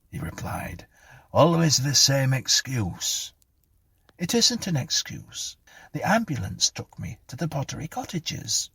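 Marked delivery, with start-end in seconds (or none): 1.3-3.3 slow, louder, higher-pitched reproach; exasperated rebuke. A narrator's reading. Policeman rebukes Clara. 4.2-8.7 high-pitched, insistent protest; defensive Clara. A narrator's reading. Voices Clara.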